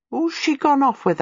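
high, eager gossip's question; agog question. A narrator's reading. Servant asking the maid about the runaway mistress, agog and high. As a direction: ask it agog, pitched right up. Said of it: "I said".

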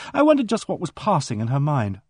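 usual speaking voice

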